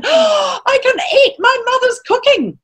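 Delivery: high-pitched, delighted gasp into the words; excited rat. Acted. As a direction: squeal it like a hungry little rat catching a smell. Rat excitedly smells mother's cooking.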